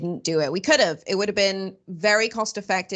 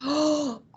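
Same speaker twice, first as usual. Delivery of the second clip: higher-pitched gasp of disbelief; shocked gasp; 6 semitones higher than usual. Real, not acted. Hearing he has never been to Utah, shocked gasp. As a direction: gasp in astonishment.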